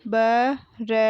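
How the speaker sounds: बरसाया broken into ba, ra, saa, yaa; बरसाया letter by letter with gaps between; spelled out. Shown as a demonstration. Teacher sounding out बरसाया for pupils, syllable by syllable. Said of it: "मैंने इस तरीके से पढ़ा".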